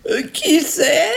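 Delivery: high, slow granny imitation; fake grandmother. Ogress correcting her voice for the girl, fake granny.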